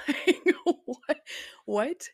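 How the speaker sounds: laughing. Real, not acted. Laughing at absurd accusation.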